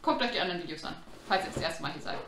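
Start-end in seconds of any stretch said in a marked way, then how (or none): none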